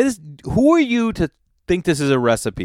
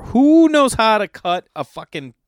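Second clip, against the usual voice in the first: high, loud disbelief; exasperated loud rant; outraged yell; 9 semitones higher, 6 dB louder than usual. Real, not acted. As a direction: blurt it out in outrage. Outraged at partridge recipe.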